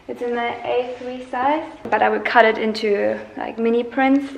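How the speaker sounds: ordinary delivery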